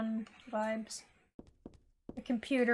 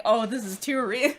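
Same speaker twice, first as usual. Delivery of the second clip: mock flustered; 6 dB louder than usual. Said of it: "You're like".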